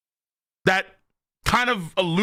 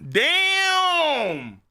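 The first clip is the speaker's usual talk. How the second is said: high drawn-out damn; real amazed exclamation; 10 semitones higher than usual. Real, not acted. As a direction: stretch it in awe. Hyped reaction.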